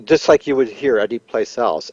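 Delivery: ordinary talk, nothing marked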